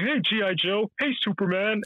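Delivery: high put-on toy voice; high, sing-out playtime character voice; same voice for both toys. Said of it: "G I Joe would have the same voice".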